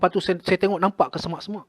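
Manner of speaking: ordinary talk, nothing marked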